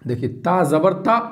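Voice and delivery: ordinary talk, nothing marked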